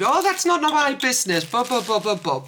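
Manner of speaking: high mock scolding, trailing down; mock yelling. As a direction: imitate her brush-off, starting high and dropping off. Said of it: "she was still yelling at me".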